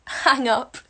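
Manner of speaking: words caught in a laugh; real laughing. Real, not acted. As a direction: break into giggles as you say it. Breaks into laughter mid-sentence.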